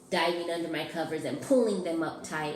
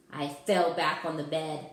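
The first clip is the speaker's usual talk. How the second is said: plain reading voice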